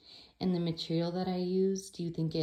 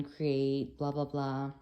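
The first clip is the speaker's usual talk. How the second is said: ordinary talk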